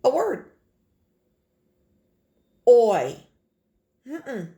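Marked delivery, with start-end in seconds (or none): 2.7-3.2 Oi punched out loud; sounding out oi. Shown as a demonstration. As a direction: hit the sound Oi hard. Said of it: "Say it with me now".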